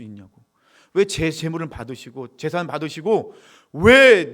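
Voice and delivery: acted loud complaint to God; mock-aggrieved worshipper demanding answers; indignant protest. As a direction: protest loudly, drawing out the grievance. Voices Cain's protest to God.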